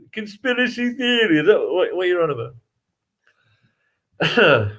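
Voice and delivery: imitating a broad Yorkshire pub local; Yorkshire dialect. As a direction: play a dopey Yorkshireman in the pub. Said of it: "They talk like this around here".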